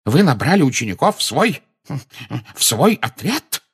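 high, incredulous question with a stumble; astounded. A narrator's reading. Minister asks Dumbledore about his recruits, astounded.

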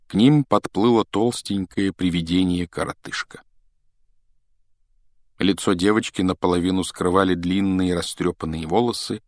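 usual speaking voice

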